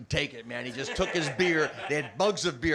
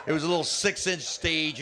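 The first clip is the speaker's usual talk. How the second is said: usual speaking voice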